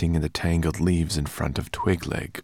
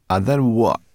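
impatient brownie voice, louder; gruff brownie; 5 semitones higher, 9 dB louder than usual. Acted. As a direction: brownie interrupts the boy sharply. Sorrel's gruff voice cuts in.

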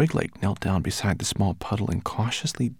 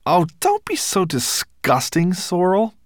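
disgusted; 11 semitones higher, 6 dB louder than usual. Acted. Ben scolds Sorrel.

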